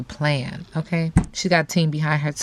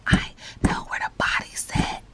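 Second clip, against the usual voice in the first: hushed, voiceless whisper; 4 dB quieter than usual. Pretend. Reader voicing the spirit's secret to her audience, whispered. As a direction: breathe it out like a dark secret. Secret told in a spooky aside.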